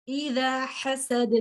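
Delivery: حسد drawn out slowly, ending on a bounced د; teacher recites the last verse slowly with qalqalah; slow qalqalah recitation. Shown as a demonstration. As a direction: recite اذا حسد slowly and let the final د echo. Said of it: "یہ جو sound ہے".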